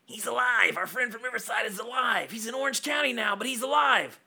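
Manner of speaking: host reads the letter's Marty line, a hint of Marty McFly. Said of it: "Now in your best Marty McFly impression".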